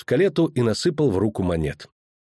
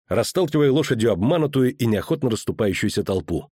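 plain reading voice

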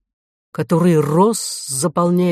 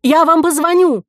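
high, loud hurried call; parting shout; 11 semitones higher, 6 dB louder than usual. A narrator's reading. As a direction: shout it while dashing off. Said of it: "крикнула Ко". (громкий крик на бегу)

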